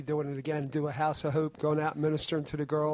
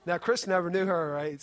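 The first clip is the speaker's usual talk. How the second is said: ordinary delivery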